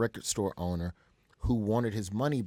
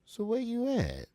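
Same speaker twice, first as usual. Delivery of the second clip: [name] imitation; 13 semitones higher than usual. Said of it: "And [name] goes".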